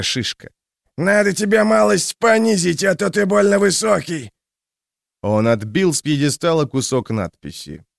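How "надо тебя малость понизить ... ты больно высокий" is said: jeering thug voice. A narrator's reading. Thug mocks statue.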